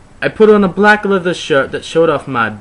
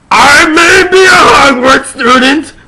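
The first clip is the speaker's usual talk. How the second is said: high loud crying shout; hammed-up defiant wail; dramatic declaration; 11 semitones higher, 7 dB louder than usual. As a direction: melodramatic outburst.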